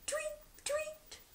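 very high, soft chirping; birdsong. A narrator's reading. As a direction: chirp like little morning birds. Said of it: "went the birds".

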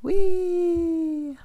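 put-on high delighted squeal of a child; gleeful whee. Little bear squealing on the ride up. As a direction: squeal with joy as you're lifted.

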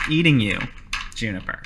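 hushed, savouring creature voice; hungry bear. Acted. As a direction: softly relish the prey, lightly voiced bear. Bear savours the girl it eats.

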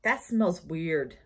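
ordinary talk, nothing marked

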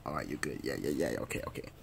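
deep, quiet placating; low and soft. Acted. Placating the other, low and soft. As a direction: mumble it down low.

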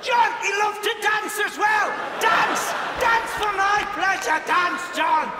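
high, loud bossy order; mock commanding shout. Heckler ordering John to dance for his pleasure, shouted. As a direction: shout the command at full height.

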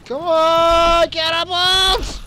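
high, loud, building shouts at the catapult; frantic yelling. Real, not acted. A player screaming at his catapult and soldiers during the fight, frantic. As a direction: scream it on in rising panic.

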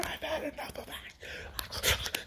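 high, slow, whispered slur; slurred words. Acted. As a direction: slur it out under your breath. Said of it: "He's just slurring his words".

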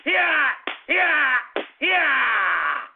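drawn-out falling "yeah" with each lash; pretend whipping yell. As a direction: yell as you swing the belt. Pretends to whip with belt.

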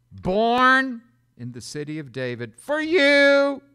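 building to a loud, high "for you"; preaching proclamation. Acted. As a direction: swell from spoken to a ringing cry. Preacher quoting the angel.

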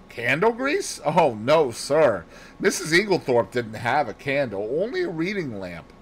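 put-on servant girl, high on the puzzled question; puzzled maid answering earnestly; surprised maid Annie. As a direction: deny it as a puzzled, eager maid.

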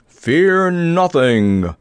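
very slow, booming knightly command; grand boom. A narrator's reading. Sir Hokus reassures the frightened party, booming grandly. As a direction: boom it out, stretching every word. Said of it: "boomed Sir Hokus grandly".

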